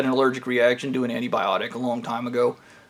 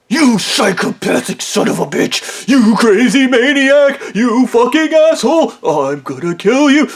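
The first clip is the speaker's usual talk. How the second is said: high, loud, ranting shriek of rage; furious screaming insults; 8 semitones higher, 4 dB louder than usual. Retold or imitated. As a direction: scream at him like a maniac. Coworker screaming insults at him.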